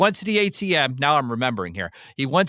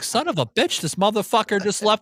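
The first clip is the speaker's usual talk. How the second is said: exasperated inner rant; 8 semitones higher than usual. Retold or imitated. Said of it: "I'm like".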